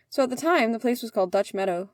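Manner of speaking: ordinary delivery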